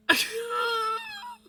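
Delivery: high, drawn-out mock cry; imitated shocked reaction. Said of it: "she was just like".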